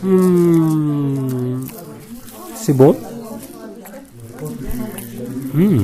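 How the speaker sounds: talking with a mouth full; tasting with a mouth full. Real, not acted. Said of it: "je vais parler la bouche pleine".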